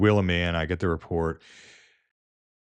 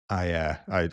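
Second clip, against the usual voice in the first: ordinary talk, nothing marked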